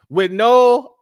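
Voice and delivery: high, loud burst of indignation; outraged shout. Real, not acted. Host telling his audience the drivers can't read signs, outraged shout. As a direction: throw the words out in disbelief.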